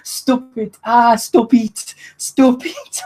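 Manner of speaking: high pleading stop it, stretched out; begging stop it. Retold or imitated. [name] on his knees begging the [name], in a high pleading voice. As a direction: beg them to stop, laughing. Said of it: "walking on his knees".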